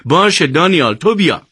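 exasperated, pitched well up; fed up. A narrator's reading. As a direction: cut the quarrel short, out of patience.

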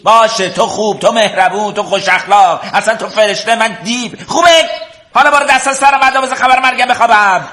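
high-pitched, exasperated mock praise; angry sarcasm. Brother mocking his sister's scolding so he can sleep, angry sarcasm. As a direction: pile up sarcastic concessions, pitch way up.

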